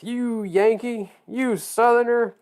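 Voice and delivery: high, drawn-out teasing voice; slow, high singsong jibe; mock taunting. Mimics both sides teasing him.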